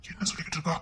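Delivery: unvoiced whisper to himself. Husband whispers a puzzled aside about his ranting wife. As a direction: breathe it as a hushed aside. Aside while she rants.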